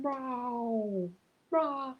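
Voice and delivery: high, whiny mock meow of a complaining cat; imitated cat meow. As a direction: meow like the ginger whinger downstairs. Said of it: "who's downstairs going".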